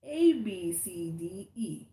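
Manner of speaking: "A B C D E" said letter by letter, slowly; spelled out. Shown as a demonstration. Revealing how the girl's name is spelled, slow letter-by-letter reading. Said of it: "it is spelled".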